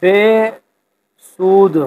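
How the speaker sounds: ordinary talk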